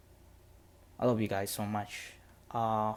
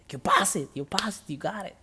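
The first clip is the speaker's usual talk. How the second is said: much higher, thrilled cry of good news; excited announcement; 9 semitones higher, 5 dB louder than usual. Retold or imitated. Female drill sergeant telling him he passed, excited high cry. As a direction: burst out with joy for him.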